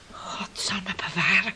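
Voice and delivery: breathless whispered prayer under the breath; frightened whisper. Acted. Mother whispering fearfully to herself about her son. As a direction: whisper it, scared to even say it.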